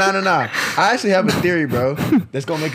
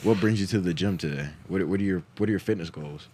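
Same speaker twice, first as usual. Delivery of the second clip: a lower, softer, smooth everyday voice; real voice; 8 semitones lower, 5 dB quieter than usual. Pretend. Acting out chatting up a girl at the gym, low and smooth. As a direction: drop back to a calm cool tone. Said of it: "that's not even my real voice".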